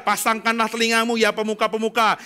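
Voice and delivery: loud, declamatory Bible reading; scripture proclamation. Acted. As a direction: proclaim the verse, stretching the final phrase. (membacakan ayat dengan lantang)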